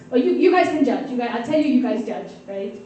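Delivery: ordinary delivery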